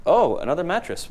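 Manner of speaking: usual speaking voice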